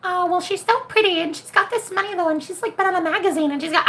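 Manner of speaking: high mocking whine of a jealous client; insecure client. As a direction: squeak it out like a jealous girl. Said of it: "they're like".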